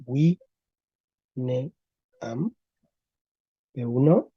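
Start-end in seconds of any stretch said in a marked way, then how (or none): 0.1-2.5 "wi, ne, am" as separate syllables with long pauses. Shown as a demonstration. Teacher shows the class the first stage, syllable by syllable. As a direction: say "wi, ne, am" apart, starting with a w. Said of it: "P cero sería".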